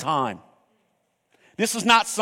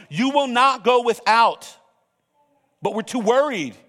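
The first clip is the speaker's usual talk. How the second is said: preaching; 4 semitones higher than usual. Acted. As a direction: build the sermon up with conviction. Preaching builds.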